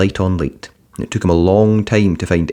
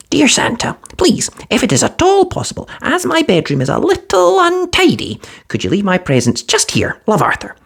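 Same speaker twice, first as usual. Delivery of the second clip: put-on much higher, earnest child reading his letter; young boy Arthur, polite and careful. A narrator's reading. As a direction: read the note like a hopeful small boy. Arthur reads his note.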